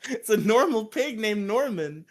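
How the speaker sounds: high, loud, pleading objection; protesting Rangers. Retold or imitated. Rangers protesting to Zordon about killing the pig, high. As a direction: whine that it's just a pig. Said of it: "they're like".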